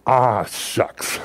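lightly voiced disappointed grandpa; imitating a grandpa's mild dismay; Grandpa Herm. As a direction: let the first word sag, mildly let down. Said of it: "whenever something didn't quite go right".

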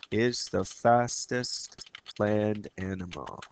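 ordinary delivery

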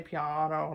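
piano with a low dark ah; the low American piano. Shown as a demonstration. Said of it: "like, really low".

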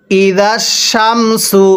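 শামসু with a thick, heavy শ and a slow, drawn-out delivery; slow demo of a thick শ in শামসু; heavy shin sound. Shown as a demonstration. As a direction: round out the শ in শামসু, weighty and slow. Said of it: "নক্তাওয়ালা শিনটা আমরা মোটা করে পড়ব".

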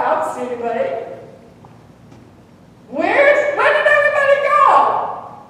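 fast, then high bewildered cry with a laugh; preacher voicing Elijah, mock bewildered; lonely prophet. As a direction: play it half-comic, lost and alone. Elijah voiced looking around alone.

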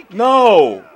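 loud, high, drawn-out falling protest; frustrated cry. Real, not acted. Gamer protesting a setback, loud frustrated cry.

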